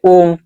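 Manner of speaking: ओम held longer; stretched om. Shown as a demonstration. Teacher lengthening ओम to show a pluta vowel. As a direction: drag out ओम. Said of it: "तो हम ओम ऐसे बोले".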